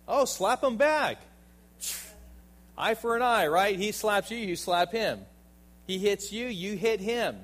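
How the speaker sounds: loud, high, sarcastic jab; hammed-up tit-for-tat, shouted; mocking the natural urge to hit back; mock worldly retort.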